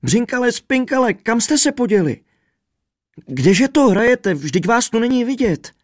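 high, loud frantic wail; distraught shouting, pitched high; raving lament. A narrator's reading. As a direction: rage and wail after lost companions. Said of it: "Běsnil a bědoval".

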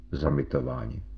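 low, faint, barely there; shadow of a voice. A narrator's reading. Shaken Fitz finds his voice at last, a faint shadow of it. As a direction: let only a trace of voice out, soft and sunken. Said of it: "vlastně jen stín hlasu".

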